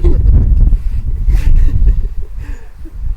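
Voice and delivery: high breathy laugh; real laughter. Real, not acted. Reader amused by the grim story, high breathy laugh.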